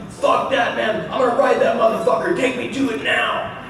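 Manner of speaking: high-pitched mock yell of a friend; hollered, high comic impression; imitated yelling. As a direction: act out a friend yelling wildly. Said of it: "Rita's yelling".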